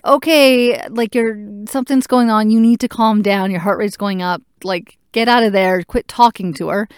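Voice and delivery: urgent doctor telling the nurse off. Retold or imitated. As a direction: cut through the panic with an order. Doctor orders calm down.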